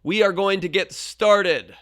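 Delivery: loud call to the room, slowing at the end. Retold or imitated. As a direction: raise the voice over a chatty class. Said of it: "a little bit louder this time".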